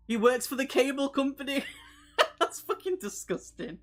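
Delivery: high laughing outburst; loud giggling disbelief. Real, not acted. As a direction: laugh through the words. Laughing at a joke.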